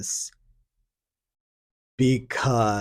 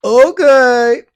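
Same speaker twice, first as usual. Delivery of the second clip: much higher, louder mock girl agreeing; hammed-up naive girl saying yes; naive teen girl; 13 semitones higher, 12 dB louder, slower than usual. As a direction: chirp it like a clueless teen. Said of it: "Eighteen-year-old says".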